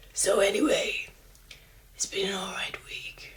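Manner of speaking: hushed smoker-man delivery of So anyway; drag-king voice, breathy and put-on; put-on man's voice. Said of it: "but it's my man's voice".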